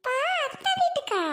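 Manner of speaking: put-on high child voice, eager and bright; high-pitched young boy; excited child. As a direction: play the little son asking daddy. Child asks father about aunt.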